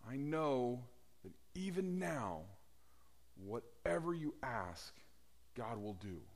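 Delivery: quietly trailing off; soft fading quote. Retold or imitated. Preacher quoting Martha, fading to hushed.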